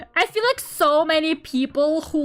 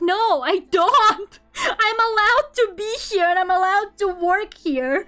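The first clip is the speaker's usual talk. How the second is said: raised, mock-outraged comeback; indignant retort; 4 semitones higher, 3 dB louder than usual. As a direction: push back loudly in playful outrage. Acts out indignant reply to interviewer.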